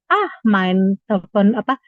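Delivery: usual speaking voice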